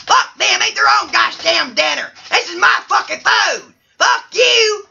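hammed-up angry outburst, pitch jumping high; ranting bachelor. Bachelor three refusing dinner guests, high hammed-up rant. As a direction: spit out an indignant, shouted refusal.

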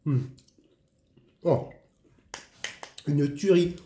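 talking while chewing; mouth full. Real, not acted. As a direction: spoon at lips. Seen 3: Spoons dessert from cup, spoon in mouth at 2.2s.

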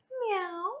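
high little meow; sweet mock meow; kitten meow. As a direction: mew like a tiny kitten. Kitten sound in story.

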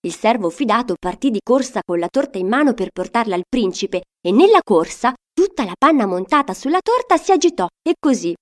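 ordinary delivery, nothing marked

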